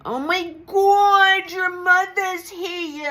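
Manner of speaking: over-the-top mock TV medium shriek; mocking the Long Island Medium. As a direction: ham up a showy medium's big reveal. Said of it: "some of the T V shows like the Long Island Medium".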